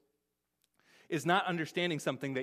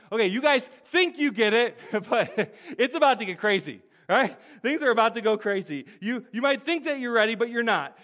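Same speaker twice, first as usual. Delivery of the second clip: mock warning; 5 semitones higher, 6 dB louder than usual. Paraphrasing Jesus' warning to disciples.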